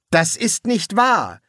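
high, slow, emphatic protest; vehement denial. A narrator's reading. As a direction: protest it hard, word by word. Polly rejects news of regiment.

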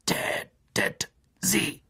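langsam geflüstert, gereizt; gereizte Riesenspinne. Acted. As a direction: hauch den Befehl langsam und gereizt. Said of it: "klickte Aragog gereizt". (gereizter langsamer Flüsterbefehl der Spinne)

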